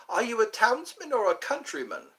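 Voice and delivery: high imitated British lady; British accent. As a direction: ask it as a mock-British lady. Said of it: "I didn't know she was British".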